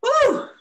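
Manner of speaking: very high, loud woo; host whoops after a poem ends; cheering whoop. Real, not acted. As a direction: whoop for the poet.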